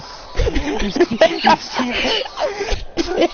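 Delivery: loud, high, long cackle; crazed villain laugh. Acted. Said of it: "is just laughing".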